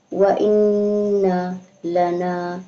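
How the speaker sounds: slow, drawn-out chanted recitation; tajweed recitation, slow and measured; Quran recitation. Shown as a demonstration. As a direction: recite it melodically, stretching each vowel. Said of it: "teacher recites the verse again".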